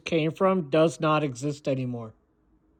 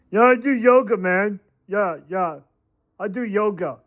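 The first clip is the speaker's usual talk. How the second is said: higher, put-on boastful voice; mock boast in a raised voice; mocking yoga bragger; 5 semitones higher than usual. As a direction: mimic a yoga convert bragging, pitched up.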